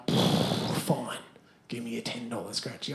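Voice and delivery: under the breath, giving in; resigned mutter. Retold or imitated. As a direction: mumble it, defeated. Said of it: "And so I'm like".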